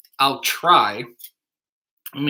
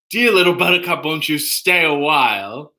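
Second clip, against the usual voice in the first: spoken sitcom nemesis impression; Newman from Seinfeld. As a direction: recite the lyric in character, without singing. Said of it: "is Newman from Seinfeld".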